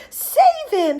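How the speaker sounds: high, loud panicked cry; screaming. A narrator's reading. Mother as her son falls in river, screaming. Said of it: "screamed Missus Gloop".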